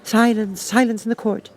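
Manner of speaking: high, called-out command for silence; crying out. Acted. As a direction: call the court to order.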